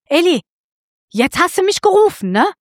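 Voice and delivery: high, eager little Samuel; child Samuel. Acted. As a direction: pipe up like an eager little boy. Boy Samuel runs to Eli.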